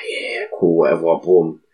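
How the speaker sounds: mock casual brushing off; feigned calm. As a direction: play it cool under your breath. Pretending calm to police.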